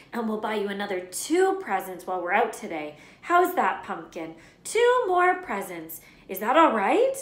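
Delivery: high, loud anxious cooing; nervous mum bribing her son; placating mother. A narrator's reading. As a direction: soothe him before the tantrum. Petunia placating Dudley quickly.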